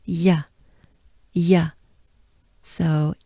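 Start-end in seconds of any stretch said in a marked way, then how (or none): 0.1-1.7 "Y" as a gliding French sound. Shown as a demonstration. Teacher modelling the Y sound for learners. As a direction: glide through "Y" slowly. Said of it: "we have the sound Y".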